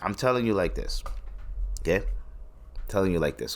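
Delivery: ordinary delivery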